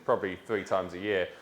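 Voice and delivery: usual speaking voice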